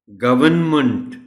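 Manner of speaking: गवर्नमेंट as "GUV-un-munt", loud; correct, silent r. Shown as a demonstration. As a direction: model गवर्नमेंट with n, no r. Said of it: "आर silent है". (گورنمنٹ بغیر r، n کے ساتھ)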